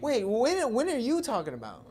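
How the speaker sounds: wait, what? pitched high and loud; surprised disbelief. Real, not acted. Friend cuts in, baffled by the fight claim, surprised. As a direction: jump in, baffled by his claim.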